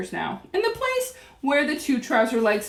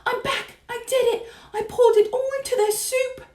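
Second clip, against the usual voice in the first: high little mouse voice; excited whisper; 13 semitones higher than usual. A narrator's reading. Mouse reports the soup deed to Grandmama, whispering. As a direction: squeak the news in a small high voice. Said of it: "I whispered".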